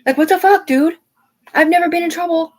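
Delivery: loud, very high-pitched outburst; upset protest. Retold or imitated. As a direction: protest indignantly, voice shooting way up. Upset outburst recalling being mistreated.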